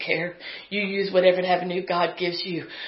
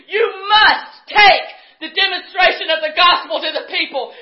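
much higher and louder, shouting sermon at full force; fiery preaching; 11 semitones higher, 8 dB louder than usual. Real, not acted. Preacher challenging the congregation to witness, fiery shouting. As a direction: drive the challenge home, climbing with each line.

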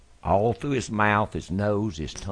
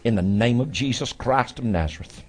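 laying a curse; 4 semitones higher than usual. Real, not acted. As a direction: command the illness out in prayer. Praying over the sick man.

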